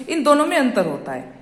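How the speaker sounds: ordinary delivery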